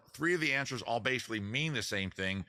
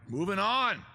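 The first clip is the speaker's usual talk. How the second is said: upbeat announcement; 7 semitones higher, 5 dB louder than usual. Real, not acted. Host moving quiz along.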